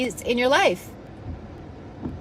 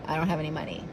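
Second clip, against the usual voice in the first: small, low, soft mock excuse; meek excuse; 8 semitones lower, 10 dB quieter, faster than usual. Friend answers an offer of abundance, meek and quiet. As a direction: mimic a feeble excuse, hushed and low.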